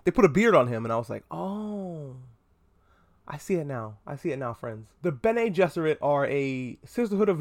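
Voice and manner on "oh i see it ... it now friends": much softer, hushed and dreamy; awed realization. Retold or imitated. Admiring bearded Oscar Isaac to friends, hushed and dreamy. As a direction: melt as it finally clicks.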